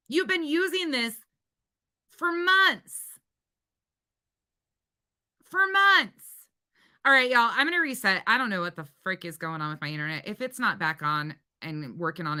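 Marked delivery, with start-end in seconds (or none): 0.1-6.2 exasperated high shout. Real, not acted. Yelling at rival.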